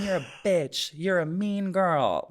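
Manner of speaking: high, drawn-out mock insult; mock accusing commenters.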